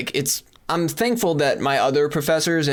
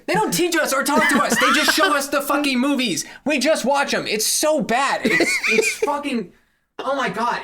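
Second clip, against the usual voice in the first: higher yelled rant with laughter; laughing yell; 10 semitones higher than usual. Real, not acted. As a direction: yell from far off the mic. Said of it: "which is why I'm yelling".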